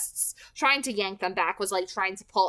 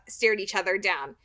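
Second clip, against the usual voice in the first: ordinary delivery, nothing marked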